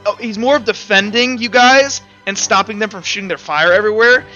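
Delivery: ordinary talk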